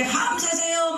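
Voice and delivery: high street call; a chanting shout to the neighbours. Retold or imitated. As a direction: call it like a wedding box carrier. Wedding box carriers calling out.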